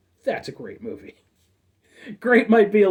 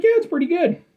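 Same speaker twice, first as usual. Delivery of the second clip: imagined viewer; 11 semitones higher than usual. Pretend. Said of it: "be like".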